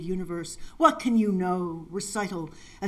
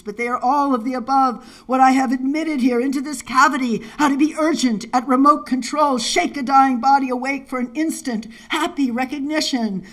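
raised, louder, urgent recitation; impassioned poem reading; 6 semitones higher, 8 dB louder than usual. Acted. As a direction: push the verse out high and urgent.

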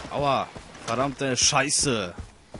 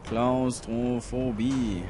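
usual speaking voice

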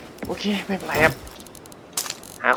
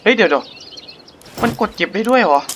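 surprised; 7 semitones higher, 4 dB louder, much slower than usual. Real, not acted. Surprised the box can be picked up.

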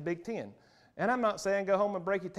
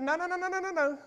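mocking denial; 8 semitones higher, faster than usual. Mocking objectors' refusal.

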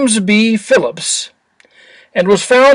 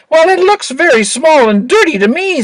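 put-on high, light young angel voice; sweet bubbly cherub voice; 7 semitones higher than usual. A narrator's reading. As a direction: sound like an eager little cherub. Little angel complains.

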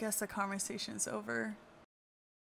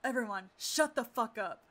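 loud, high demand for silence; urgent shout; 7 dB louder than usual. Acted. Woman silencing her friends as disaster breaks, urgent shout. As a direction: cut through the room, loud and urgent. Silencing the room after terrible news.

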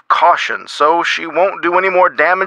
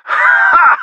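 single high, sharp "Ha!"; sudden high-pitched laugh of delight; burst of laughter; 4 dB louder than usual. Acted. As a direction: bark out one very high laugh. Laughs at the news.

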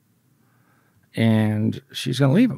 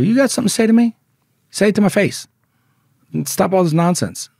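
loud, higher-pitched challenge; forceful retold dare, raised voice; retold confrontation; 9 semitones higher, 7 dB louder than usual. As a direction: re-enact squaring up to someone. Retold confrontation in the prison yard.

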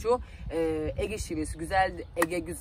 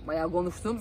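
usual speaking voice

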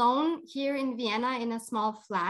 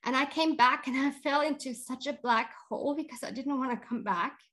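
normal talking voice

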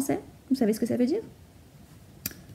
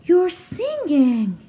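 slow delighted realisation; 6 semitones higher, much slower than usual. Acted.

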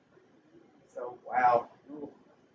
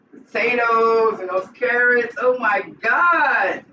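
slow, much higher and louder cry of delight; excited shout; 6 semitones higher, 4 dB louder, slower than usual. Real, not acted. Cook showing viewers the cooked potatoes, excited shout. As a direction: shout with joy at the vegetables. Sees finished stew in pot.

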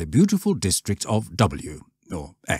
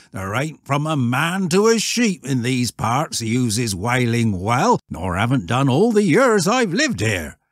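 emphatic rustic voice, pitched up and loud; old shepherd; 7 semitones higher, 5 dB louder than usual. Acted. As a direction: countryman insisting.

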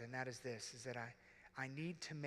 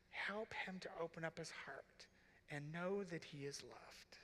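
put-on whispered child prayer; 2 semitones higher than usual. Daughter praying over her broken father, slow hushed whisper. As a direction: hush it like a child praying at bedtime. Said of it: "What do you say?".

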